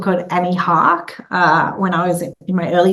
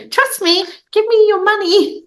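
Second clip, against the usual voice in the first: put-on young pitch voice; 13 semitones higher than usual. Young founder pitching a boardroom for money, very high girlish voice. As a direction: play a cute kid asking adults for millions. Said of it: "I'm like".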